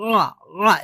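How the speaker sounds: "রা" said as throat-pressed "ʿaa"; ayn sound. Shown as a demonstration. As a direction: squeeze mid-throat for "রা", no rolled r. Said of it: "এভাবে".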